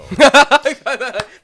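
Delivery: big loud cackling laugh; loud burst of laughter. Real, not acted. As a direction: burst out laughing loudly, for real. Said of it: "Thanks for coming, you guys".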